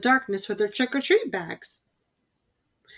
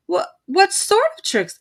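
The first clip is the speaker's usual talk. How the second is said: nervous stammer; 10 semitones higher, 3 dB louder than usual. A narrator's reading. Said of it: "asked brother".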